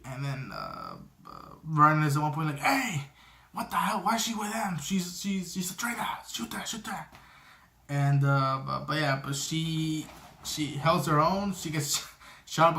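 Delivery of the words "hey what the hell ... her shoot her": high, hushed, agitated mock outburst; mock indignant protest. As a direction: squeal an accusation at half volume. Acting out Vernon's panicked protest.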